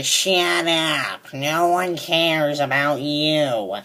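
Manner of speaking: slow, low sneering imitation of a coworker; hint of a nagging coworker, mocked slowly. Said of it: "she'll butt in and say".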